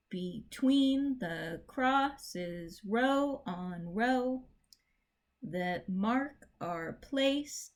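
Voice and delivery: slow, measured recitation of the poem; poem reading. Shown as a demonstration. Teacher reciting the poem for students to mark, slow and measured. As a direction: read each line slowly, letting the beat show. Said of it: "try to mark this".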